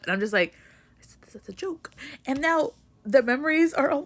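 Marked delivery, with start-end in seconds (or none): 1.3-1.9 breathy whisper, no voice at all; whispered aside. Retold or imitated. Reader whispering to herself about the book. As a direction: whisper it like a secret joke.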